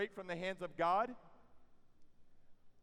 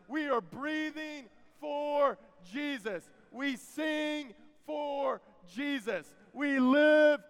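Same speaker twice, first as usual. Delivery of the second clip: loud, higher, drawn-out proclamation of faith; full-force preaching; 6 semitones higher, 6 dB louder, slower than usual. Real, not acted. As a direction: build it slow and loud, stretching each phrase.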